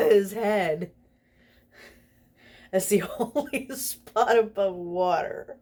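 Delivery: can't hold back the giggles; reading the funny spider bit through laughter; laughing while reading. Real, not acted. Reader laughs mid-sentence.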